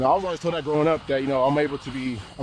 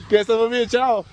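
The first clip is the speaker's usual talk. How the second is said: excited loud joking; 7 semitones higher, 5 dB louder than usual. Real, not acted. Joking loudly in Spanish.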